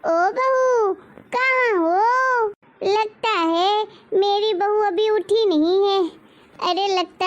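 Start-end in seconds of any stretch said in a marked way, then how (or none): 0.0-2.5 high, drawn-out call across the house. Acted. Mother-in-law calling her sleeping daughter-in-law, drawn-out call. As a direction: stretch the call to wake her.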